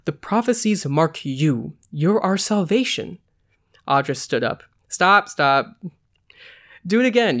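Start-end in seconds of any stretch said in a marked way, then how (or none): 0.1-3.2 slow intoning, lifting at the end; mildly theatrical priestess delivery; intoned. A narrator's reading. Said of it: "she intoned". 4.9-7.4 louder, rising interruption; emphatic halt to the rehearsal; calling a halt. A narrator's reading. Director cuts off the rehearsal.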